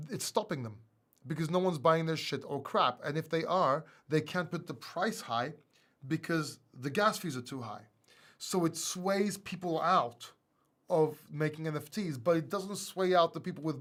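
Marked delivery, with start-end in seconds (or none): none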